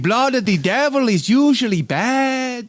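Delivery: mock Mexican waiter warning; Speedy Gonzalez-style accent. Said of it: "a Speedy Gonzalez impression".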